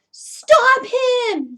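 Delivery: high loud yell; old man yelling for help. Acted. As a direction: shout it after the thief. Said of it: "yelled the man".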